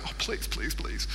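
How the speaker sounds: breathy whispered begging; will him on under your breath; pleading whisper. Retold or imitated. Parents in the audience whispering please. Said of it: "His mum and dad are going".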